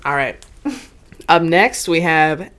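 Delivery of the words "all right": ordinary delivery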